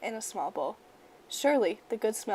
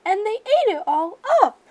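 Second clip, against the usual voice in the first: put-on Baby Bear squeaks much higher and louder; high squeaky voice; 11 semitones higher, 9 dB louder than usual. A narrator's reading. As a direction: squeak like a small bear cub. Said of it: "in her high, squeaky voice".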